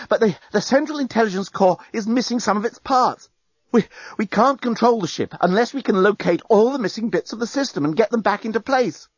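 high, agitated explanation; panting. A narrator's reading. Journalist explaining the ship can't be controlled, panting. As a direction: stumble urgently through the bad news. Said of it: "he panted".

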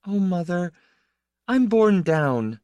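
a weary cry from someone worn down; overwhelmed, near tears. A narrator's reading. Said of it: "and began to laugh, then cry".

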